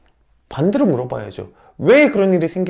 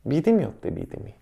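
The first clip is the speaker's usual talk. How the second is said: usual speaking voice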